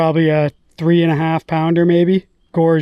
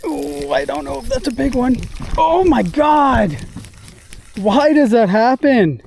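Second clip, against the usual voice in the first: excited; 7 semitones higher than usual. Real, not acted. Angler reacting as the fish jumps, high and stunned.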